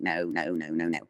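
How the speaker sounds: low, dismissive string of no's; flippant refusal. Retold or imitated. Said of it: "I was being very flippant to him".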